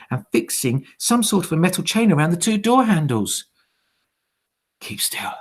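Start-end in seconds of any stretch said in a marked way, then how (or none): 4.8-5.3 soft unvoiced whisper; boy in hiding whispers to himself. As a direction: hiss it to yourself, frozen in hiding. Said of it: "I told myself".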